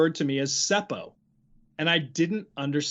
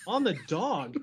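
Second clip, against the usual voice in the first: baffled echo; 4 dB quieter than usual. Retold or imitated. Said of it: "And I'd be like".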